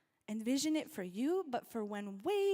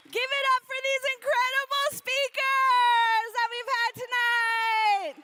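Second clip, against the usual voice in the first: hype MC; 12 semitones higher, 14 dB louder, slower than usual. Real, not acted. MC hyping the crowd.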